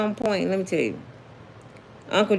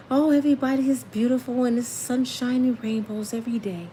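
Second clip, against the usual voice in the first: airy, softer, higher preacher voice, lightly done; ghostly Joel Osteen; 4 semitones higher, 4 dB quieter than usual. Retold or imitated. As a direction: float the words like a dreamy ghost. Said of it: "don't he talk like a ghost to y'all".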